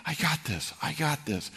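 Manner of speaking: winded, mock-breathless self-talk; huffing and puffing. Runner telling himself he can make the hill, out of breath. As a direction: push the words out between breaths, a touch. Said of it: "slowing down, huffing and puffing".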